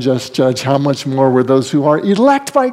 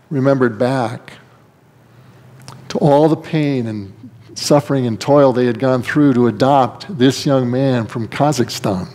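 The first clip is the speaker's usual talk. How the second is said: normal talking voice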